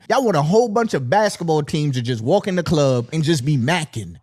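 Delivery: ordinary delivery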